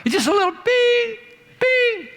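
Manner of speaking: high imitated bing; ping it like a phone; playful high bing. Shown as a demonstration. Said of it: "That's called a ping".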